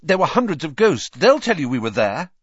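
high, loud eager explanation; urgent protest. A narrator's reading. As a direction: insist you have witnesses. Children protest their alibi.

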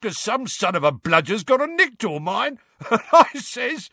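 high, strained voice breaking into laughs; Mundungus, choked with laughter. A narrator's reading. Rogue telling friends how he swindled Will, choked with laughter. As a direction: tell it through helpless laughter, pitched right up. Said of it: "choked Mundungus, tears running down his face".

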